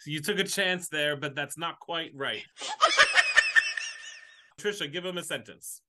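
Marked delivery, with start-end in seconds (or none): 2.5-4.1 loud high burst of laughter; big laugh. Real, not acted. Host laughs loudly.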